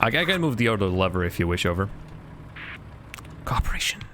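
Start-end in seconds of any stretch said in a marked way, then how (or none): 3.4-4.0 breathy secret whisper. Acted. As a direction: whisper it like a password. Whispered aside during co-op game.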